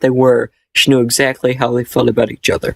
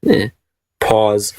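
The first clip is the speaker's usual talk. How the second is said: ordinary delivery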